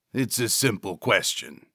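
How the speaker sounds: grind it like a diesel engine; grating gravel voice. Acted. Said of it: "His vocal cords grated his voice like cheese".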